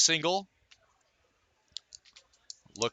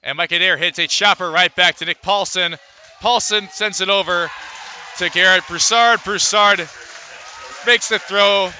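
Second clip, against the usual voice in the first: excited play call; 3 semitones higher, 4 dB louder than usual. Real, not acted. Double play call rising.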